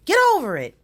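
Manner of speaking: loud get over it. Real, not acted. Ranting at Trump camp.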